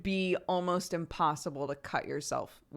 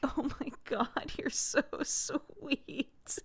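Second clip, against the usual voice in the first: touched; 9 semitones higher, 3 dB quieter, much slower than usual. Retold or imitated. Mom recalls her tearful reply.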